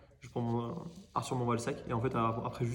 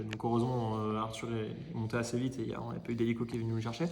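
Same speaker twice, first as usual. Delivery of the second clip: normal talking voice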